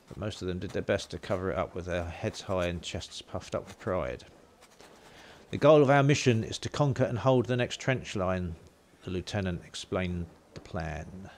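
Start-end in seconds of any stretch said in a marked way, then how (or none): 5.5-8.6 loud, firm military briefing; commanding officer voice. Acted. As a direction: address officers with authority. Voicing lieutenant's briefing.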